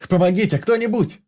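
high, loud yell; yelling for help. A narrator's reading. Vanya holding the doctor back, shouting for help. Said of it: "орал Ваня".

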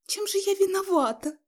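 very high plaintive cry; tearful wail. A narrator's reading. Mother backed against the wall by her son, tearful. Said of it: "заплакала она".

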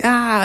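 short high-pitched mock shriek; imitated yelp of horror; mock scream. Parents see the mutant and hang up, screaming.

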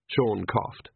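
usual speaking voice

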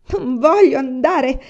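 high, loud, slow wail; sobbing refusal. A narrator's reading. Son refuses to leave the country, sobbing protest. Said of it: "singhiozzò Iwan".

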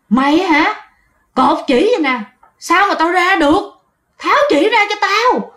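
put-on angry spirit screaming through the old mother's body; possessed old woman roaring. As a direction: snarl it out like a furious ghost. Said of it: "la gào lên um sùm".

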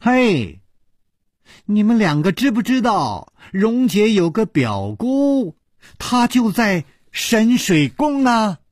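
put-on girl calling up eagerly from below. A narrator's reading. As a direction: shout the news across the deck. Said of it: "又叫起来".

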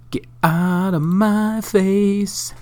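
high, slow, drawn-out goofy voice; silly high voice. Pretend. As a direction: stretch it out in a squeaky cartoon voice. Repeats the phrase in a silly voice.

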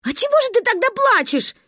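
put-on high, exasperated child's question; losing patience. A narrator's reading. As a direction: burst out impatiently. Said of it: "не вытерпела Каринка". (не вытерпев, высоким голосом)

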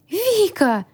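high squealed call; startled squeal. A narrator's reading. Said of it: "взвизгнул хранитель".

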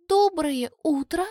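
high, slow cartoon princess chirp; sweet chirp. A narrator's reading. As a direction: chirp it sweetly to win him. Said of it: "мило прощебетала Тори".

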